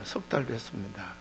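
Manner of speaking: low, hushed retold reply; imitating a subdued schoolboy; meek pupil. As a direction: answer the teacher meekly, very softly. Boy meekly answers teacher.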